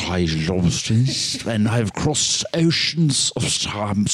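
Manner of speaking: put-on slow, mumbled Oldman Dracula accent, like swallowing yogurt; weird mumbly accent. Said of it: "weird mumbly accent where he goes".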